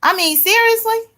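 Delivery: high, incredulous seriously; seriously asked in disbelief; exasperated. Real, not acted. Exasperated at repeated question.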